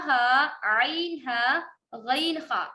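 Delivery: ہا عین ہا غین خا drawn out slowly; name each throat letter slowly; slow letter naming. Shown as a demonstration. Teacher lists throat letters: slow, deliberate. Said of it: "like ہم نے پڑھا حمزہ".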